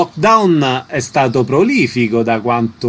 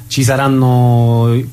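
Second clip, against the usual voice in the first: ordinary delivery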